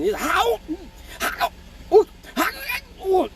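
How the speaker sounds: high, broken laugh-howls; imitated stuttering yelps. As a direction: stammer out pained yelps between blows. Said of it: "a stuttering Chinese person".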